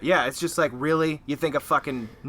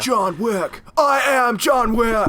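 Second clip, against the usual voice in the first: slow, shouted, much higher action-hero voice; hammy slow-motion Keanu shout; mock John Wick; 8 semitones higher, 5 dB louder, much slower than usual. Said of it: "weird Keanu Reeves impersonation".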